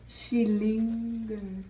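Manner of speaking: usual speaking voice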